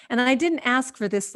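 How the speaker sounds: ordinary talk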